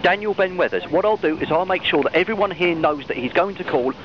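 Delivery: ordinary talk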